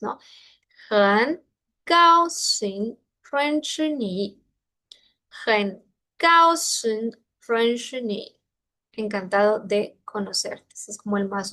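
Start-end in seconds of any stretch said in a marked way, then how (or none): 0.9-7.1 很高兴 drawn out slowly, each tone exaggerated; tone demonstration. Shown as a demonstration. Teacher showing the play of tones, slow tone demonstration. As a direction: model 很高兴 syllable by syllable, 高 held high. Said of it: "es un juego de tonos".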